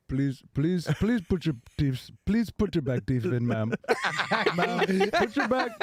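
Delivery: put-on Indian accent, laughing; begging Indian accent. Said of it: "That's very good".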